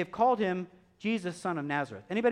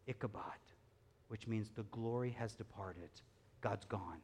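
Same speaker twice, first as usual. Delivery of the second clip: low, near-whispered solemn line; grave hush; 8 semitones lower, 15 dB quieter than usual. A narrator's reading. Hushed telling of God's departure.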